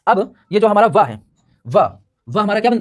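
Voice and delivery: normal talking voice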